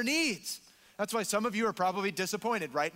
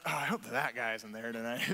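low, quick comic grumble; reluctant grumble; 8 semitones lower, 4 dB quieter, faster than usual. Acted. Churchgoer dreading someone at community group, comically reluctant. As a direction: drop the voice into an unenthusiastic private aside. Acts out the real, petty prayer.

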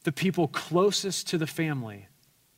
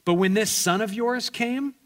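resentful elder son; 4 semitones higher than usual. Acted. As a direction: spit out the grievance at his father. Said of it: "he gets to the real reason he's upset".

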